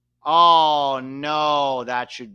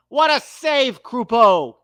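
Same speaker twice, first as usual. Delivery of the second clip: high, loud praise; excited cheer; 6 semitones higher, 6 dB louder than usual. Real, not acted. Fan cheering Canada's keeper Krupo after a save. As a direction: shout the save with joy.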